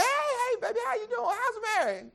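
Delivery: high bright parental hail; parents greeting Joseph. Acted. Joseph's parents hailing their son, high and cheerful. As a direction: call out like doting parents.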